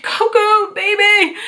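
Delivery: high, cooing call to her cat. Real, not acted. Reunited with her cat at the shelter.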